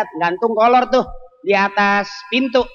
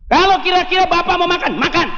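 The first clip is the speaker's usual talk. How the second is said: acted wife; 9 semitones higher than usual.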